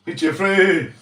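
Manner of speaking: loud high call. Real, not acted. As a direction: shout his name across. Calling out to puppet.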